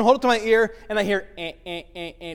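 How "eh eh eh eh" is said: a soft mock beeping busy tone; busy signal. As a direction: imitate a phone line that is busy. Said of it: "busy signal".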